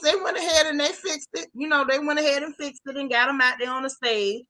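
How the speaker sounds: normal talking voice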